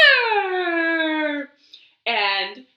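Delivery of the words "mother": calling mother. Acted. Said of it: "he called".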